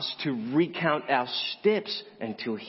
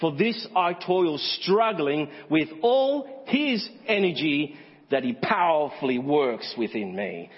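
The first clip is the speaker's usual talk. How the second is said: STRUGGLING and ENERGY drawn out high; emphatic, strained recital; 3 semitones higher, slower than usual. Acted. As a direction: stretch out STRUGGLING with all HIS ENERGY, slowly. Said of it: "hear how he says it".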